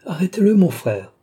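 high, loud playful command; merry call. A narrator's reading. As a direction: shout it in high spirits. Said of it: "toujours riant". (ordre crié gaiement)

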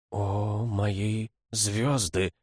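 slow, hushed awe from a lioness; stunned. A narrator's reading. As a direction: draw it out in amazement. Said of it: "воскликнула львица потрясённо". (потрясённо, медленно)

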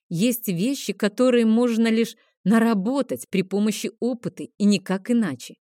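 ordinary delivery

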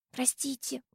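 tiny, soft, high squeak; timid squeak. A narrator's reading. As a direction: squeak a very quiet apology. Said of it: "пискнула Диана".

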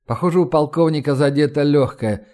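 plain reading voice

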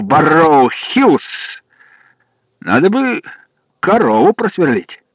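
high, cheery country farmer; jolly farmer voice. Acted. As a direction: play a chatty farmhand. Farmer caller's voice.

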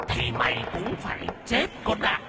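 a ghost breathes a death threat into his face; hissing ghost. A narrator's reading. Ghost hisses at Mr Nghĩa that he must die, eerie whisper. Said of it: "mà rít lên".

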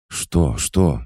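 startled exclamation. A narrator's reading. Goddess rushing to her mistress, startled and frightened. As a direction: cry out in alarm. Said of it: "испуганно воскликнула богиня".

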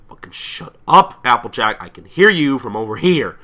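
loud, high irritated shout; angry yell. Retold or imitated. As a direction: snap back at a noisy friend, loudly. Acted angry yell of a pony.

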